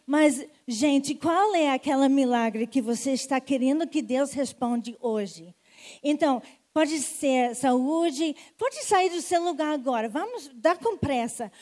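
loud, urgent altar call; rally the congregation forward; preacher calling the crowd to the altar; full-force preaching. Acted. Calls people forward to pray.

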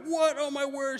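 high, loud mock gasp of shock; shocked servants. Servants react to the accusation, high shocked gasp. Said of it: "everyone's like".

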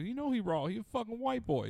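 imitating a squeaky high voice; high-pitched voice. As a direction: pitch way up, rapper's squeaky drop. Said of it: "It's a high-pitched voice".

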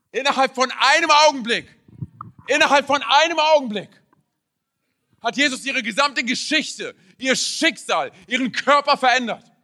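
predigen mit voller Kraft. Real, not acted. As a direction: drive each phrase home to the church. (eindringlich und laut predigend)

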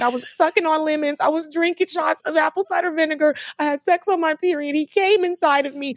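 high, rushed, tumbling confession; frantic confession. Retold or imitated. Confessing everything to the doctor in a high frantic rush.

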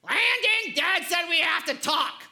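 loud, high call across the house; high-pitched childish yell, half-mocking; kid screaming for brother. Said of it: "I'll hear him scream".

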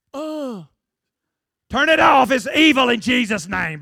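hammed-up shouting churchgoer; hammed-up preacher rebuke. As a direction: cast out the evil phone like a fiery preacher. Super-spiritual believer rebuking the phone.

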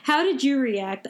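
normal talking voice

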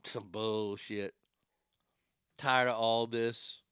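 low, slow, weary grumble; grumbling aside. Acted. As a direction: mutter it fed up, pitched well down. Grumbling character aside mid-bit.

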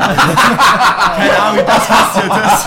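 loud laughing through words. Real, not acted. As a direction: laugh hard while speaking. Laughing at clown story.

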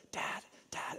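put-on a child's soft whisper. Little son wakes his dad early, whispering. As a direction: whisper it so nobody else wakes up. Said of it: "he just, like, whispers".

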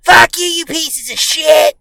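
high, slow, drawn-out holler; mock heckling yell. Neighbors passing the kids, mock heckling yell. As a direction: imitate a neighbor yelling abuse in passing.

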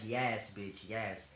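ordinary talk, nothing marked

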